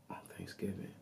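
whispered under the breath; a pointed whispered aside about the neighbor. Real, not acted. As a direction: drop to a bitter whisper. Bitter punchline about the neighbor.

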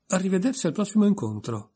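high, bright forced goodbye; put-on cheerful farewell. A narrator's reading. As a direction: pile on all the cheer you can. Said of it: "mettendoci quanta più allegria possibile".

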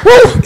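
high shouted whoop; celebratory woo. Real, not acted. Pastor hyping the congregation before trivia, whooping. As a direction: let out a joyful woo.

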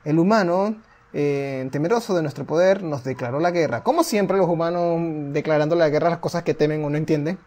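lightly dramatic storyteller read; measured, solemn narrator; story narration. A narrator's reading. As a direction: tell the old war like a legend. Reads the game's story text aloud.

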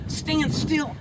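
high, snappy stand still; mock the impatient owner barking an order; mock impatient handler. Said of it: "and you're like".

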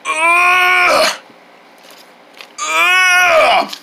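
long, high lifting groan; straining grunt. Pretend. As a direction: groan with all your effort. Man struggles to lift heavy hand.

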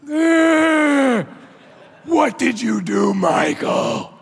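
loud, high mock shout at husband; imitating a furious wife shouting; wife yelling. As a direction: bellow it like an angry wife. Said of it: "That's my wife, by the way".

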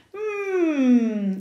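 long, thoughtful "mm", pitch sliding down; drawn-out considering hum; pondering hum. A narrator's reading. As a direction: hum while mulling it over. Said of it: "se dijo el zorro a sí mismo".

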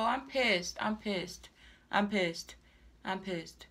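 soft, low, sinking repetitions of anger; quietly pissed. Real, not acted. Wig buyer resigned to a wasted purchase, quietly pissed.

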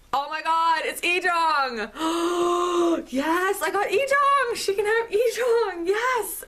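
high, loud squealing delight; real thrilled fan scream; overexcited. Real, not acted. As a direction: squeal it with gasps between phrases. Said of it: "I'm getting too excited".

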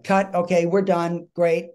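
director's wrap call. Retold or imitated. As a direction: call it out to the whole set. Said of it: "they're like".

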